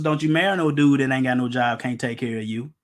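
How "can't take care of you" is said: normal talking voice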